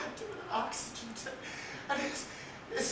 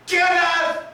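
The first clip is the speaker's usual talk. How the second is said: louder and higher desperate shout; shouted name; 4 semitones higher, 18 dB louder than usual. Acted. To the dead boy in the hospital room, a desperate shout. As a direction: scream the boy's name. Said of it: "and I shouted".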